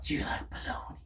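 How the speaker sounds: hushed unvoiced whisper of do you like bologna. Pranking a stranger in the street, whispered. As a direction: breathe the question with no voice. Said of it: "be like".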